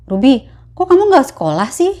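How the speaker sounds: mother's puzzled question; mother asking. Acted. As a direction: ask why she skipped school.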